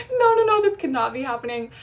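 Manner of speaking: high, loud no no no, then calming a little; reliving the moment the Wi-Fi died; retold panic, shrill burst that eases off; panicked.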